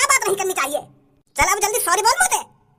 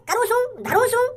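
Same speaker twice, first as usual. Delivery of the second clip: mocking Haryanvi. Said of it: "तो को पागल बना रही है".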